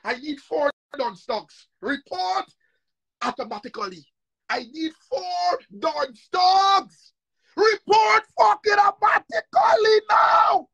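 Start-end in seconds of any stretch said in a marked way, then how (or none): 4.5-10.7 very slow, much higher and louder, crying out; tearful roaring order; raging shout. Real, not acted. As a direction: scream the demand in fury. Furious demand to followers.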